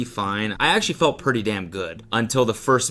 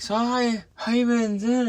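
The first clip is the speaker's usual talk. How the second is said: high, chirpy host imitation; mock podcast host; 13 semitones higher than usual. Podcast hosts greeting him, in a high mock-chirpy voice. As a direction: chipper interviewer voice, pitched way up. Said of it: "They're gonna be like".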